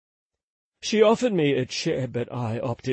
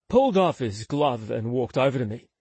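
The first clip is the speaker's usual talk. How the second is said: ordinary delivery, nothing marked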